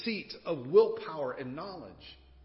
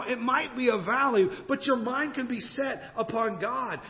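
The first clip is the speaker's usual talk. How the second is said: higher, driving preacher's cadence; preaching; 7 semitones higher, 5 dB louder than usual. Real, not acted. Preacher urging congregation to change their thinking, impassioned. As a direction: exhort them with building repetition. Preaches exhortation at full force.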